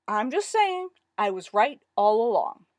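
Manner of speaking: loud, sulky protest starting high; sullen. A narrator's reading. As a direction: sulk while insisting on being right. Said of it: "Jeffrey said sullenly".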